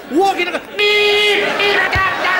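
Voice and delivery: imitating a car horn at a pedestrian; mock car horn. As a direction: beep beep, high and loud like a horn. Mimicking angry car horn at pedestrian.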